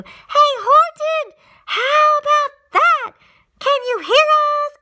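very high, loud, stretched yell; calling up. A narrator's reading. Mayor calling up through the hullabaloo, high loud yell. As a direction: holler up over a great din. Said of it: "the mayor called up through the howling".

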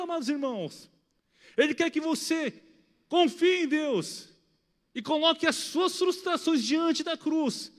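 loud, high, slow pulpit preaching; fiery preaching. Acted. Pastor urging the brothers to trust God, preaching loud. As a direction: preach it with full force to the congregation.

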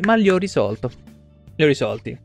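normal talking voice

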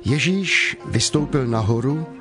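ordinary talk

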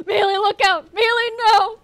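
panicked call out; alarmed shout. Real, not acted. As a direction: yell to warn a teammate. Ally under attack.

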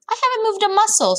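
put-on high-pitched girl's voice; high storybook Sophie, indignant; protesting little girl. A narrator's reading.

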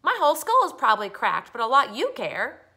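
high, loud aggrieved protest; loud complaint. Acted. Boy tells family his skull is cracked, loudly aggrieved. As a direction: accuse them like a wounded kid. Boy's complaint reread with corrected wording.

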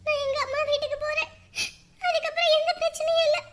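high, plaintive crying; tearful resignation. Acted. Wife offering to leave for mother's house, tearful resignation.

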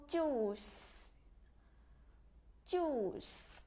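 Juice said softly with a long oo; model pronunciation. Shown as a demonstration. Teacher modelling Juice for pupils, soft and clear. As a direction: model Juice gently for repeating. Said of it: "Các em đọc theo cô".

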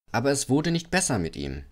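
usual speaking voice

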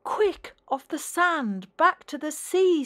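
high, loud urgent cry; a tiny snail crying out in panic; cried out. Acted. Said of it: "cried the snail".

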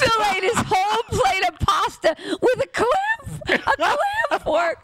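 laughing. Real, not acted. Laughing story at the table.

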